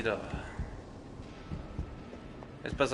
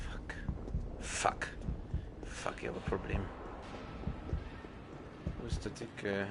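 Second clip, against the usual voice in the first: whispering; 6 semitones lower, 14 dB quieter than usual. Real, not acted. Hiding from enemy.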